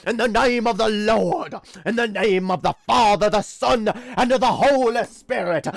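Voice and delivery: high, loud revival preacher shout; preaching at full force. Pretend. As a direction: cast it out like a tent preacher. Parody faith healer exorcising.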